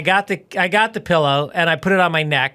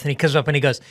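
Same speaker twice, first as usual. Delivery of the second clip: ordinary talk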